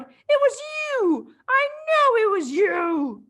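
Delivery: high, loud and slow accusing roar; furious accusation. Acted. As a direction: hurl the blame at her. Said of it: "she roared".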